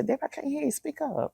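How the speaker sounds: high, soft mock plea; people asking her to speak up. Friends who can't hear her raspy voice, high and pleading. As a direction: imitate friends straining to hear. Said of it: "Sometimes people say".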